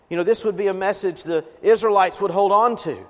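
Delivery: ordinary delivery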